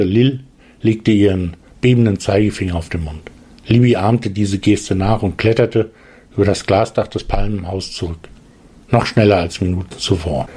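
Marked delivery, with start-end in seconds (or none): none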